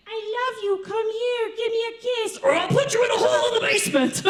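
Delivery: sweet parrot voice that suddenly turns menacing; creepy parrot voice. Retold or imitated. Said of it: "This one was like".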